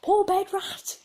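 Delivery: high, loud squawking parrot; shrill pet parrot screech. Retold or imitated. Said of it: "a parrot".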